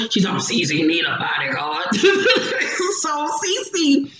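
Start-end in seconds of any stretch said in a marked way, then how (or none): 0.4-1.9 hoarse lost voice. Retold or imitated. Imitating Evelyn croaking after shouting herself hoarse. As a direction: squeeze it out like your voice is gone. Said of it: "she done lost her voice".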